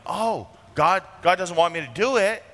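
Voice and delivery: a sulking king complaining, hammed up; lightly voiced whining king; whiny Ahab. Ahab whining about the prophet.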